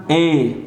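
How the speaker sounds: ä said as a long "ای"; German umlaut "ای", one pure long vowel; umlaut sound demo. Shown as a demonstration.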